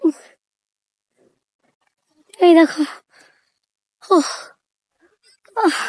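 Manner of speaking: panting between short words; out of breath. Real, not acted. Vlogger climbing uphill, out of breath from the climb. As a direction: puff and gasp from the climb. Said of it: "আমার শ্বাসের খুব কষ্ট হচ্ছে".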